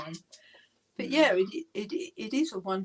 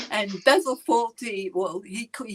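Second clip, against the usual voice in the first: ordinary talk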